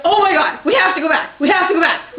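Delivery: loud, high, repeated insistence; urgent excitement. Retold or imitated. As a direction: imitate a friend urging the driver back. Retold urgent plea to return.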